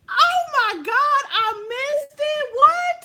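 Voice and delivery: high, loud squeal of shock; stunned disbelief. Retold or imitated. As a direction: shriek like you missed the big event. Stunned she missed fight.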